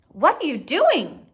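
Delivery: loud, high sudden question; startled call. Acted. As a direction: catch him in the act. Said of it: "Ma asked as she came".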